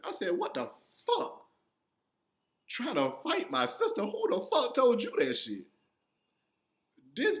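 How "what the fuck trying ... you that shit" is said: high-pitched mock outrage; acted indignant outburst; retold indignant protest. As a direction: squeal in disbelief, voice jumping way up. Retold quarrel with accusing father.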